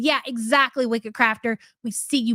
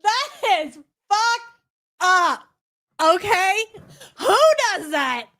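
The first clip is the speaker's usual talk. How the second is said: high, laughing incredulous outburst; laughing in disbelief; 6 semitones higher than usual. Real, not acted. Reacting to the played clip, laughing in disbelief. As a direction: crack up, pitched well above usual talk.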